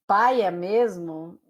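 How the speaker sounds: slow, loud "paia mesmo" with an ai in "paia"; emphatic, drawn-out "paia mesmo"; paia variant. Shown as a demonstration. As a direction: stretch "paia" out, clear S in "mesmo". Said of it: "olha como muda".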